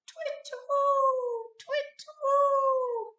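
very high, slow hooting; owl hoot. A narrator's reading. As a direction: call out like an owl in the dark. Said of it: "went the owl".

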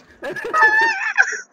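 loud burst of high laughter; big laugh. Real, not acted. Friends lose it at a game mishap, big laugh. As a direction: crack up hard at the game.

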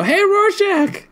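high, drawn-out greeting call. Retold or imitated. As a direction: call out high, stretching the name. Greeting someone they run into.

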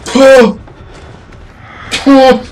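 urgent shout. Real, not acted. Urgent yelling during a chase.

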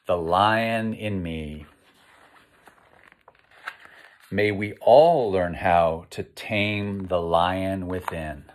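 put-on slow storybook read for children; picture book reading. A narrator's reading. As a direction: read the title slowly to little listeners. Reads the picture book aloud.

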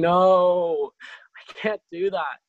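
high, loud panicked "No"; reliving a loud inner protest; retold loud refusal. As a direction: blurt the refusal out in mock alarm. Retold refusal to pray for girl.

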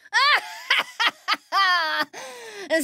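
hoge krijsende heksenlach; triomfantelijk gekrijs. Acted. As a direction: lach als een heks die gewonnen heeft. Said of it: "De heks krijste triomfantelijk". (hoge triomfantelijke heksenlach)

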